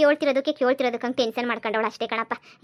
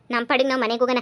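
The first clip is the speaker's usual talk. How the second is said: usual speaking voice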